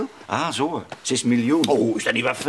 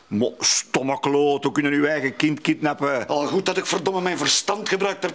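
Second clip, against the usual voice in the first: normal talking voice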